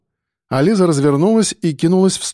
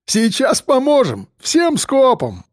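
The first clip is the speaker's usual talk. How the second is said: jeering; 10 semitones higher, slower than usual. A narrator's reading. Рыжий mocking the captive.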